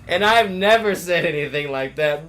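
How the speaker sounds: loud, incredulous echo of a denial; loud mocking repeat. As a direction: throw the denial back mockingly.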